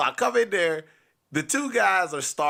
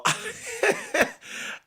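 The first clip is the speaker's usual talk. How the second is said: squealing high giggle; high-pitched laugh. Real, not acted. Storyteller catching himself before a forbidden word, laughing high.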